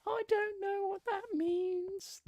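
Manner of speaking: very high, slow falsetto; slow, childlike squeak of a voice. Acted. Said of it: "It's my impression of Tiny Tim".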